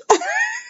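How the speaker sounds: high, breathy giggle; real amused giggle. Real, not acted. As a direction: giggle in disbelief. Laughs at the soap.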